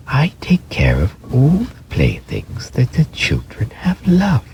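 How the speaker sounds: gentle fairy voice in a high whisper. Acted. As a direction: breathe it like a kind nursery fairy. Fairy speaks to rabbit.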